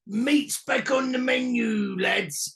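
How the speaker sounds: high, slow shouted orc growl. Retold or imitated. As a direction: bellow it like a hungry orc. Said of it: "When they'll".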